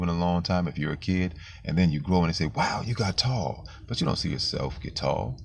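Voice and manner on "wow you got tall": higher, drawn-out mock wow; impressed, raised-pitch quote; imitated amazement. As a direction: marvel slowly like a relative seeing a grown kid. Acted amazed greeting to grown kid.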